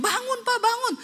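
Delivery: very high-pitched wife voice; wife's urgent plea. Retold or imitated. Wife rouses possessed husband, high urgent pleading. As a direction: plead high, urging him to wake.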